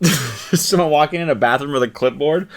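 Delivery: ordinary talk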